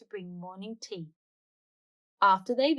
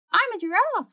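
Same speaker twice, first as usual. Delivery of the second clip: put-on much higher, louder child voice; little girl voice; 12 semitones higher, 6 dB louder than usual. Bingo on Dad's shoulders, high proud child voice. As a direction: squeak like a proud little pup.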